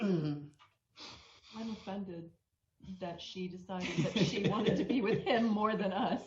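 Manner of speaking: laughing mock offence; mock offended. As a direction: play hurt as a joke to lift the mood. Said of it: "[name] saved it with a funny comment".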